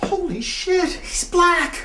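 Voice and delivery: very high-pitched, strained exclamation; squeaky, astonished outburst; flabbergasted. Acted. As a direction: let the shock burst out. Father's shock once alone.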